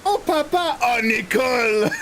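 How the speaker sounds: very high, slowed Oh Papa, Oh Nicole; Renault ad voices. Retold or imitated. Reenacting the Renault Clio advert for chat, very high and hammed. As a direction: ham up the old car advert. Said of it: "It's a car advert".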